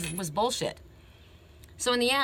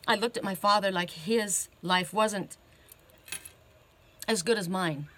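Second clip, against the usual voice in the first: getting emotional. Real, not acted. Speaker retelling a letter to the congregation, choking up. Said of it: "I'm getting emotional".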